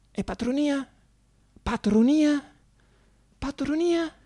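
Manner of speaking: voce molto più acuta, stupita; perplesso incredulo. Acted. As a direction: come Dio che non capisce la parola. Dio perplesso ripete la parola. (Dio sbigottito chiede, acuto e interdetto)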